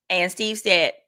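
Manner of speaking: ordinary delivery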